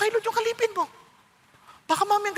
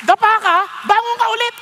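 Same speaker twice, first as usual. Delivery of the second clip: louder, punchy rally call; rousing exhortation; 4 semitones higher, 5 dB louder than usual. Real, not acted. Preacher urging the congregation on, loud and rousing. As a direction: urge them to get up again.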